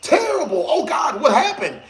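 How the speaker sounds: high, laughing horrified reaction; mock shock. Retelling reacting to girlfriend, high mock horror. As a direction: gasp it up high while laughing. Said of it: "I'm just like".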